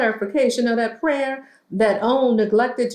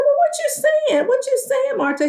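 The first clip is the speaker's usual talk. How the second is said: mock incredulous question. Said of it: "And she said".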